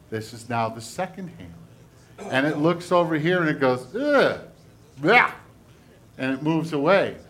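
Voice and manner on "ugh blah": high, hammed-up "ugh, blah"; disgusted noises. Second hand looks at the sick hand, mock disgust. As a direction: recoil with mock revulsion.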